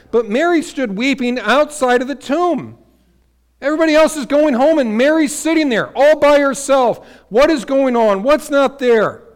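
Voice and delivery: loud, ringing pulpit preaching; full-voiced sermon climax; preaching at full force. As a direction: preach it out to the whole room. Preaching Mary at the tomb.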